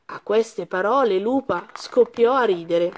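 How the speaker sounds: usual speaking voice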